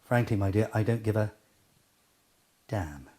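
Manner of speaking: low, quiet line, long pause before a soft "damn". Shown as a demonstration. Coach showing emphasis by pausing, long gap before a hushed "damn". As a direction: hold back, wait, then drop "damn" in quietly. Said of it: "You can use pauses".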